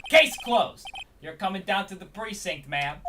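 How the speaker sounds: loud, triumphant Brooklyn cop; Brooklyn accent. Acted. Detective arresting the suspect, loud Brooklyn accent. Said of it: "Let's do a Brooklyn accent".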